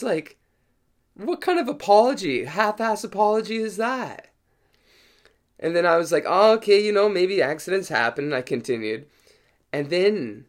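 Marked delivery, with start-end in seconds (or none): 1.1-4.3 high-pitched, incredulous complaint; indignant protest. Retold or imitated. Protesting the half-hearted apology, retold. 6.3-8.3 conceding. Retold or imitated. Retold grudging acceptance of the accident.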